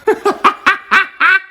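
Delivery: real, loud, high laugh; loud high burst of laughter. Real, not acted. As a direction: break into a big laugh. Laughs at own joke.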